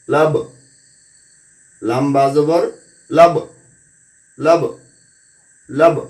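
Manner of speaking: each syllable ends in a short bounced consonant, as in আক, আত, আব; qalqalah drill. Shown as a demonstration. Teacher reciting the qalqalah syllables for students. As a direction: echo every final stop lightly. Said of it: "আমরা কিভাবে উচ্চারণ করব?".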